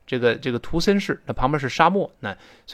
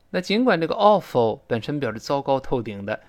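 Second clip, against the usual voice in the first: normal talking voice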